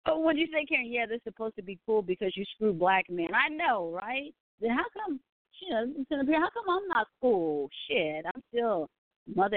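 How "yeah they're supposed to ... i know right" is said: ordinary talk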